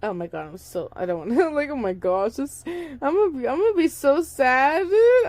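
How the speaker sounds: overwhelmed by the story, voice climbing; emotional outburst. Real, not acted. Reader breaking from the story to her listeners, rising distressed whine.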